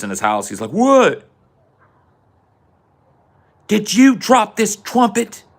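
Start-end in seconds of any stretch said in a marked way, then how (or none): 0.7-1.3 put-on high, loud kid's "what"; kid answering call. Retold boy answering his mother. 3.7-5.4 high, slow scolding mom voice; accusing mother. Retold or imitated. Retold mother questioning her son.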